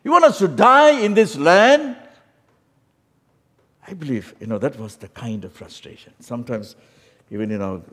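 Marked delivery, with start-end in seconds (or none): 0.0-2.0 high, loud furious ranting; angry crowd. Retold or imitated. Men in the wilderness shouting at Moses in anger. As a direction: rage at Moses like an angry mob. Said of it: "the men are really jumping and saying".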